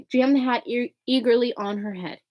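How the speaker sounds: ordinary delivery, nothing marked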